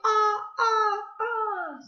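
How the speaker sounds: "ah, ah" as separate, high open vowels; vowel sound demo. Shown as a demonstration. Teacher models the ah sound for second graders, chanted vowel demo. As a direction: sound out "ah" high, one beat each. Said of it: "look at the ah sound".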